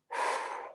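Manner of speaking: long, soft audible exhale; breathing out. Shown as a demonstration. Teacher modelling how to meet a trigger, soft exhale. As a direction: let a slow breath out. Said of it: "I'm breathing".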